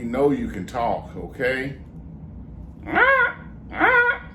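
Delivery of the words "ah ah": very high squawking parrot. Acted. Ventriloquist's parrot dummy squawks.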